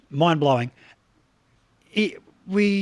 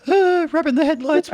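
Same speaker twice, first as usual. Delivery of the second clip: high-pitched, hammed-up camera panic; self-mocking high voice, put on; mock flustered self; 11 semitones higher, 4 dB louder than usual. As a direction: squeal it like a startled amateur on camera. Mocks himself flustered on camera.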